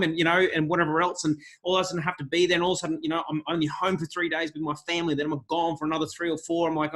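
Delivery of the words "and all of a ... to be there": normal talking voice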